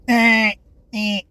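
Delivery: mock-imitating a boy's loud grunting; imitating the son's noises. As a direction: bark out "Eh, eh, eh" like the boy wandering outside. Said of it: "like that".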